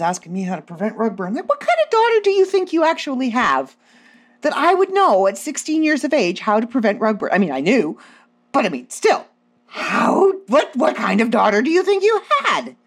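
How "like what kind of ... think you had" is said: indignant rant. Retold or imitated.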